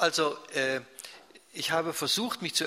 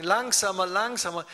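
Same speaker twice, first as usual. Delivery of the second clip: higher, louder imitated plea; 6 semitones higher, 6 dB louder than usual. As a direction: call out like an impatient listener. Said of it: "wenn dann die Leute sagen". (nachgemachter Zuruf, höher und lauter)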